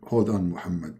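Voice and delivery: low, deep divine voice; Allah voice. Acted. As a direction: speak low like God. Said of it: "Allah, he call him".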